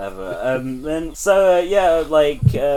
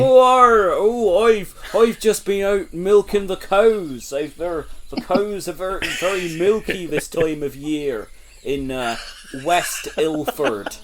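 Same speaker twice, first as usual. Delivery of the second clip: put-on West Country farmer accent; 3 semitones higher than usual. Comic farmer character milking cows.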